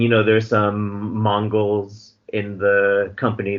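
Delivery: ordinary delivery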